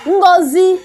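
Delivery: ordinary delivery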